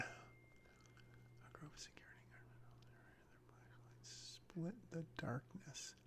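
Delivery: slow whispered reading; whispering. Real, not acted. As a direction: whisper the lines to yourself slowly. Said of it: "read, um, sort of under my breath".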